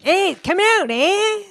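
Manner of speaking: put-on high-pitched New Zealand accent, calling out; put-on New Zealand police voice; mock New Zealand officer. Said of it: "by New Zealand police officers".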